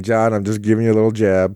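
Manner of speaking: ordinary talk, nothing marked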